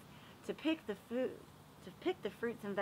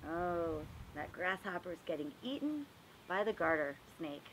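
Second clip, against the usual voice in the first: plain reading voice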